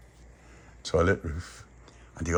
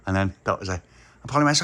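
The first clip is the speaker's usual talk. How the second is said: usual speaking voice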